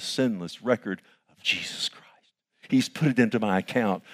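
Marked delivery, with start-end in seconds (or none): 0.0-2.3 whispered in awe. Acted. Pastor whispering to the congregation in awe. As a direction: drop to a hushed whisper.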